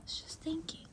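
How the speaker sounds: whispered horse's reply. Retold or imitated. Vlogger voices the horse caught at an ear, whispering. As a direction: whisper it as the horse talking back. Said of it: "She's like".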